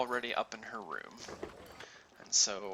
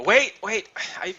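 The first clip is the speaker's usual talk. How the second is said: Kendra; 8 semitones higher, 7 dB louder than usual. Acted. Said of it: "you'll hear her go".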